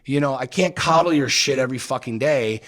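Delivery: heated husband scolding wife; heated lecture. Retold or imitated. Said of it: "I'm like".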